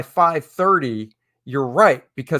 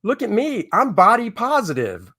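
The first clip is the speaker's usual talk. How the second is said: lightly voiced, chirpy pitch-up model; a hint of a beaming body-positive model; mock happy model; 7 semitones higher than usual. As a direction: play a smiling, carefree model.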